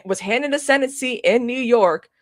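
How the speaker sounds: ordinary talk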